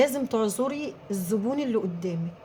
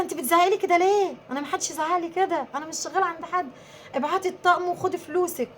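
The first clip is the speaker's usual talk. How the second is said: raised, sharper voice snapping back at a customer; indignant retort; 7 semitones higher than usual. Retold or imitated. Retelling how she told off a rude customer, indignant and raised. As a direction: push back hard, offended.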